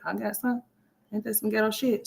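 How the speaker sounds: ordinary delivery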